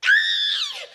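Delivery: piercing high, loud shriek; Flea's scream. Acted. Flea seeing his face, loud piercing scream. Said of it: "looked over and went".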